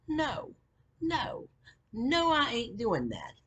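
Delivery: high, drawn-out retold refusal; high-pitched repeated no, then slowing down; adamant refusal. Retold flat refusal of an order.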